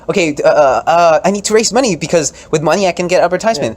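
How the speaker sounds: high, loud, excited stump pleading; hammed-up frantic politician; campaigning candidate. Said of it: "So it's like".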